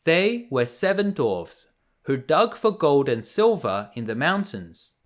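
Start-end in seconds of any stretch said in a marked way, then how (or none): none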